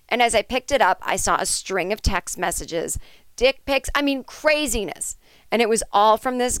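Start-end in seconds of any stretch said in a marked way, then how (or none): none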